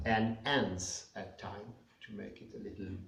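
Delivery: normal talking voice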